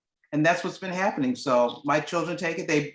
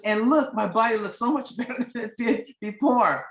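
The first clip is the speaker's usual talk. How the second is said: delighted laugh; 6 semitones higher than usual. Retold or imitated.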